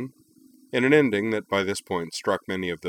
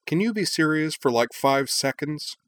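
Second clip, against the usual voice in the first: higher, slowing on five seconds; exasperated demand; 8 semitones higher, 4 dB louder than usual. A narrator's reading. Fed-up girl demanding Nall be serious, exasperated. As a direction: press him hard, drawing it out. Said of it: "Hikaru demanded".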